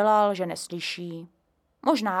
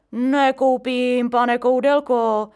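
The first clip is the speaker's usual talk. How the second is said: refusing; 4 semitones higher, 8 dB louder, slower than usual. A narrator's reading. Caretaker declines.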